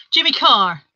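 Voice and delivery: ordinary delivery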